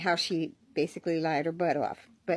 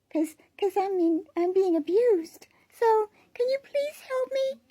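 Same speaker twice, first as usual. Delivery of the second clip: put-on very high, small child's voice; little girl voice; 13 semitones higher than usual. Shown as a demonstration. Little girl asking for help, in a very high put-on voice. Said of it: "Or I can go higher".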